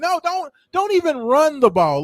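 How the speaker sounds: high-pitched, incredulous outburst; exasperated rant. Real, not acted. Sports host railing at a coach's blunder, exasperated high rant. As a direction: protest it in disbelief, voice jumping up.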